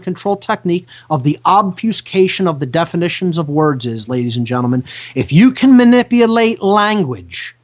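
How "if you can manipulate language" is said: louder, pushed statement; emphatic warning. Shown as a demonstration. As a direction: hit it hard as a warning. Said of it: "listen to this very carefully".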